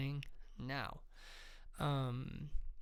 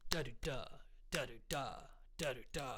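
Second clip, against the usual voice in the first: da-do-da with the first beat leaned on; trochaic pulse; 3 semitones lower, 5 dB quieter than usual. Shown as a demonstration. Teacher showing the tree's chanted pulse, first beat stressed. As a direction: tap out the pulse under the tree's lines. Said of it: "I chose a pulse, a rhythm".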